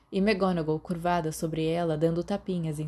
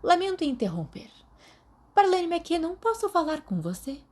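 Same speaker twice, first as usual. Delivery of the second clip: higher, crisp teacher's voice calling a student out; stern professor voice; 12 semitones higher, 4 dB louder than usual. A narrator's reading. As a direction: play the professor interrupting class. McGonagall voiced in read story.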